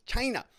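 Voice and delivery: China snapped out high; hard China. Shown as a demonstration. Host mimicking Trump's hard China again. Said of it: "he says it like that".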